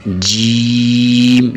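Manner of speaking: جیم held long, one madd; long sung-out جیم; drawn-out jeem. Shown as a demonstration. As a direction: stretch جیم slowly to its full length. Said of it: "یہاں جیم کو تھوڑا سا کھینچنا ہے".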